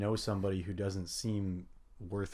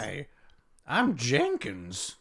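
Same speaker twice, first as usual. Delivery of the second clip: crazy voice, high, loud and stretched out; slow, hammed-up cartoonish greeting; Jenkins; 3 semitones higher, 4 dB louder, much slower than usual. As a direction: introduce yourself as a ridiculous, over-the-top character. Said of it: "unless you just use a crazy voice".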